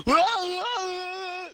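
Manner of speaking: high, loud cartoon freak-out cry; wacky imitated wail. Podcaster imitating the guy's wacky face, hammed-up wail. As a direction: wail like a panicking anime character. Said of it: "making a wacky, crazy".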